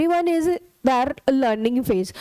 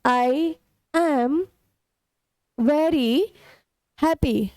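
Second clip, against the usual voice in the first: slow, word by word; much slower than usual. Shown as a demonstration. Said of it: "अब देखिए".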